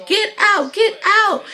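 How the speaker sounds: very high, loud yelled order; imitated hysterics. Daughter throwing out mother and uncle, hysterical yell. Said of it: "she's just hysterical".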